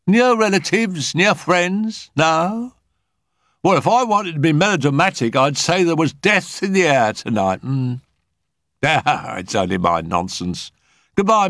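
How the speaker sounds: high-pitched Mrs Thompson voice; high, melodramatic medium turning to brisk farewells; chatty, uneasy medium. A narrator's reading. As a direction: keep it high, then laugh off the dark hint.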